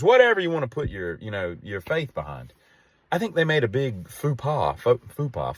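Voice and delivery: normal talking voice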